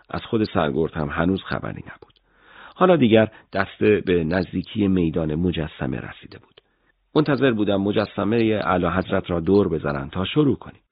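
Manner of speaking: ordinary delivery, nothing marked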